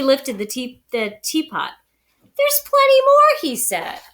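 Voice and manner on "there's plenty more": much higher, louder eager offer; anxious host pressing more tea. Acted. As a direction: rush to keep the guest there. Bear eager to keep mouse.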